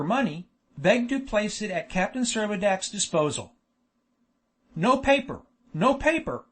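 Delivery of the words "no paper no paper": high-pitched repeated protest; exclaimed. A narrator's reading. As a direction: object urgently, pitch jumped up. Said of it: "exclaimed Isaac".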